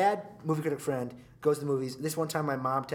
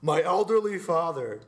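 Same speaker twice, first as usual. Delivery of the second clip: louder, higher mock outrage over his father; mock outraged radio host; 4 semitones higher, 6 dB louder, slower than usual. Radio host whining on air about the woman who tripped his father, mock outraged. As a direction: ham up the host's fake grievance about his father. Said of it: "He's like".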